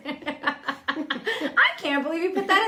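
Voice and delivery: loud, high laughing talk. Real, not acted. Host laughs at the cohost's cheeky sex remark, loud and high. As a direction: talk through real laughter, amused.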